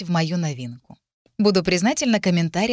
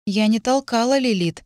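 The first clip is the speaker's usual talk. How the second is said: plain reading voice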